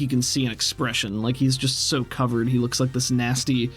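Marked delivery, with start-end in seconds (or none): none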